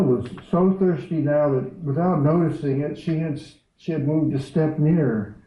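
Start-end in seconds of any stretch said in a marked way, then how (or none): none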